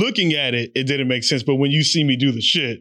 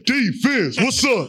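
hard cheer imitation, pitched up; mock cheer shout; 5 semitones higher, much slower than usual. Hard cheerleader re-enacting his cheers, mock shout. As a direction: push it out like a chant. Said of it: "I had to shout that shit".

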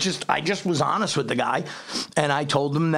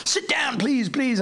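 high, eager British coaxing; imitating a flamboyant Brit urging him to sit; a hint of a flamboyant Englishman; flamboyant English guy; 7 semitones higher, slower than usual. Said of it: "He's like this flamboyant English guy".

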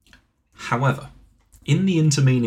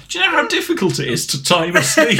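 high, louder voice through laughter; laughing; 12 semitones higher, 5 dB louder than usual. Real, not acted. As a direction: protest while cracking up. Cracking up after the timed sneeze.

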